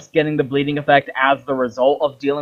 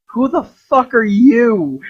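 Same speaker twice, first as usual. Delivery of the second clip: goddess voice; 8 semitones higher, 4 dB louder, slower than usual. Retold or imitated. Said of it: "and she's like".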